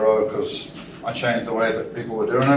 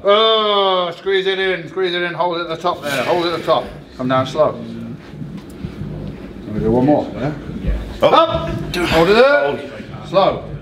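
coach shouting cues; 6 semitones higher, slower than usual. Real, not acted. Coach cueing a lift.

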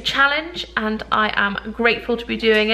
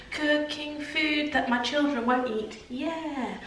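mock show host; 3 semitones higher, 4 dB quieter than usual. Vlogger introducing her cooking segment as a hammed-up TV host. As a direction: open the segment like a cheesy TV host.

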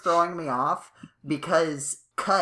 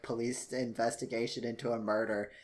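ordinary talk

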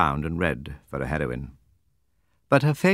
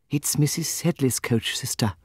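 higher, lighter voice for the timid younger sister; Jemima's voice; 7 semitones higher than usual. A narrator's reading. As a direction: lift the voice for Miss Jemima. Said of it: "said Miss Jemima Pinkerton".

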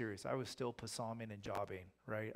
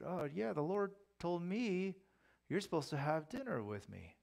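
high, sing-song fibbing; coaxing pretence; 7 semitones higher than usual. Acted. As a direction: make it up as you go, pitched up. Older prophet lures the younger one.

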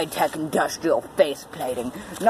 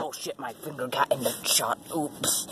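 quiet; 3 semitones lower, 9 dB quieter than usual. Real, not acted. Finger in shot, muttered.

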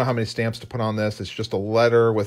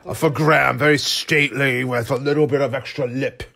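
stately British accent for a character; bad British accent. Shown as a demonstration. Illustrator demonstrating his storybook reading, bad British accent. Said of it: "that's my bad British accent".

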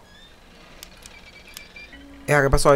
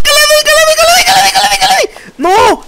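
agudísimo y fuerte, con risa al final; grito eufórico; 4 dB louder, faster than usual. Real, not acted. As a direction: celebra a gritos, subiendo sin parar. Celebra a gritos en el juego. (celebra a gritos que le dio al monstruo, eufórico)

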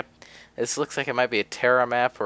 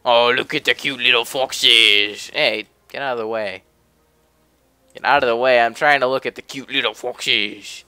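cute voice; 6 dB louder than usual. Real, not acted. Streamer fawning over cartoon foxes on the map, cutesy voice. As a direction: coo over the little foxes. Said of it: "And this is my cute voice".

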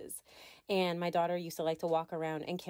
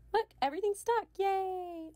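playful cheer; 12 semitones higher than usual. Real, not acted. Crafter showing viewers everything is suddenly stuck down, playful cheer. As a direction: celebrate the finished job in a high-pitched squeal. Mock celebration after skipping ahead.

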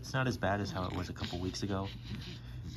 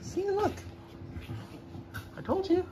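much higher sing-song voice for the dog; high dog-talk voice. Real, not acted. Talking to dog, excited reunion.

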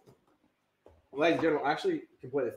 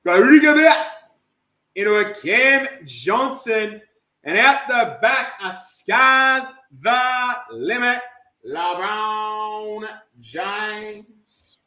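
loud burst slowing into long stretched names; arena-style player introduction; hype announcer; 5 semitones higher, 11 dB louder, much slower than usual. Acted. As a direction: announce the star with a big drawn-out finish.

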